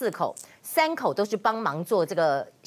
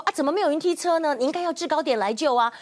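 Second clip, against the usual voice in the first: retold angry complaint; 6 semitones higher than usual. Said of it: "目击民众非常生气说".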